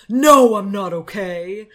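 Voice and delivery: loud, slow angry retort; angry outburst. Retold or imitated. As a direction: snap back, furious and loud. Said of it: "Colton is fucking pissed".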